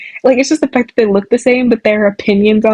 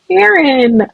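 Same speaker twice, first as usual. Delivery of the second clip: "Aaron" exclaimed higher, falling at the end; exclaiming; 6 semitones higher than usual. Shown as a demonstration. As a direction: blurt out "Aaron" like you stubbed your toe. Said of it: "I was like".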